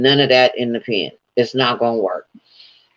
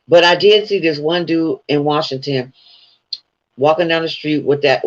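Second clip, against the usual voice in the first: ordinary talk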